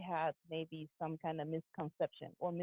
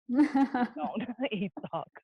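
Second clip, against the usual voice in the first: higher and louder, laughing through the words; slow, amused, laughing reply; laughing denial; 5 semitones higher, 7 dB louder, slower than usual. Real, not acted. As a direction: laugh it off while protesting. Laughing off the dog joke.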